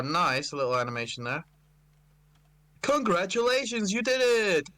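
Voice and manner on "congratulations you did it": high, bright cheer; excited congratulation. Acted. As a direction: cheer it like a proud host. Reading the congratulation email.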